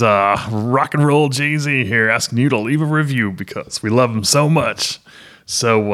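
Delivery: over-the-top morning radio host; hammed-up shock jock hyping the listener; late night DJ voice. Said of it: "our late night D J voice section".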